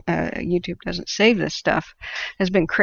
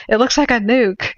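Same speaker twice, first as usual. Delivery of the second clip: higher and louder, in mock alarm; alarmed exclamation; 5 semitones higher, 6 dB louder than usual. Driver staring at the eruption, exclaiming in alarm. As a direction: blurt it out like a stunned passenger. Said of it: "He goes".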